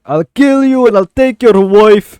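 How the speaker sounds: loud, high, slow Scottish threat; Scottish accent. Acted. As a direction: bellow it in a hammed-up Scottish accent. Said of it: "they weren't Scottish".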